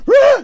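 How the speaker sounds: high loud mock panic shriek; panicked cry. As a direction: yelp in spiralling panic. Spinning out of control.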